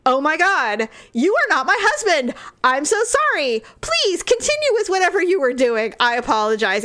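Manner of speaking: high-pitched, mortified retold apology; flustered apology. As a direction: apologize profusely, pitch jumping up. Acted apology to a mistaken stranger.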